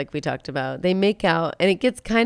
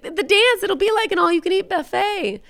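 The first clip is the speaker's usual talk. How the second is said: frantic warning; 14 semitones higher, 4 dB louder than usual. Retold or imitated. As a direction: beg him to understand, desperate. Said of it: "She's like".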